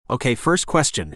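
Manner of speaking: plain reading voice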